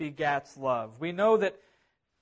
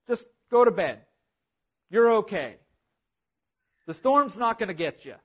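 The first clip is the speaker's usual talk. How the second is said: imitated dad calling to another room, slightly raised; dad yelling out; 3 semitones higher, 4 dB louder than usual. Dad calls to scared son in bed, mildly yelled. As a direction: call out firm reassurance, a mild retold yell. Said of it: "his dad yells out".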